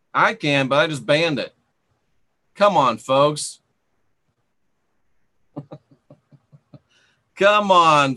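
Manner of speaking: ordinary talk, nothing marked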